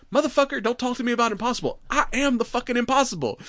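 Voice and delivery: high-pitched, fast imitated rant; acted defiant retort; mock indignant boast. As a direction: play the outraged braggart, hammed up. Retelling Otis's angry boast.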